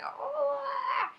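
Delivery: high pretend mad "Oh"; mock angry cry. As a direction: play a grumpy face sound. Acting a mad face.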